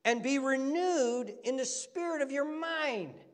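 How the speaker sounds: high-pitched, urgent sermon delivery; impassioned preaching. Real, not acted. Preacher expounding scripture to the congregation, high and impassioned. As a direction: preach it with rising fervour and force.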